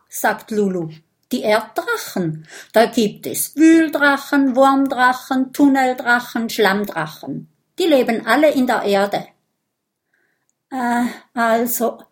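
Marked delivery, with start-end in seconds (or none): 1.3-9.3 lightly voiced, quick and keen listing; eager little dragon Lulu. Lulu tells Bisbert about earth dragons, eagerly. 10.7-12.0 stammering. A narrator's reading. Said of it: "stottert der Vampirjunge".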